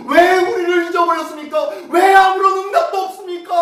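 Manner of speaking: anguished shout to God; crying out. Retold or imitated. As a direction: hurl the question at heaven. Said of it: "라고 부르짖고 있었어요".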